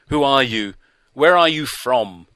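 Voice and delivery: loud, sharp interrogation; harsh demand. A narrator's reading. Doctor interrogating the stranger, loud and harsh. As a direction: fire the questions at him. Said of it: "His tone alarmed me".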